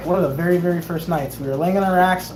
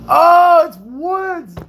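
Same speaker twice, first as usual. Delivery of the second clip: high, loud burst of surprise, then easing off; real excited shout of recognition; excited greeting; 10 semitones higher, 7 dB louder, much slower than usual. Real, not acted. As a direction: call out on spotting a friend. Spots someone, calls out.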